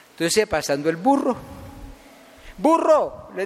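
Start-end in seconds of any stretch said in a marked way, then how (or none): 2.6-3.3 high-pitched hail; calling out. Acted. Trapped boy calls the passing donkey, calling out. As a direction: hail a passer-by with one high cry.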